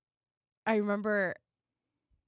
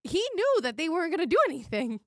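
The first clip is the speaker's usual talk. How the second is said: indignant outburst; 9 semitones higher, 5 dB louder than usual. Real, not acted. Outraged retelling of police.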